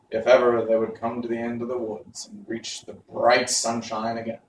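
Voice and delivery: ordinary delivery, nothing marked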